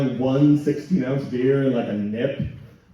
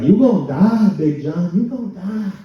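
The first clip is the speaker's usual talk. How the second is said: mock doctor warning; 5 semitones higher, 3 dB louder than usual. Doctor quoted by Big John.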